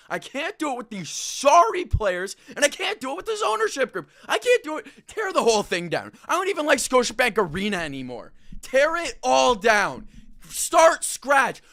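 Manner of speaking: building into a loud furious rant, ending in a shout; fed-up fan venting, rising to a yell; angry rant. Real, not acted. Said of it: "I'm gonna be loud and annoyed and angry".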